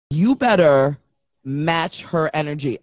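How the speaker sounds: low, measured mock warning; lightly voiced stern man, spaced out; imitated stern warning.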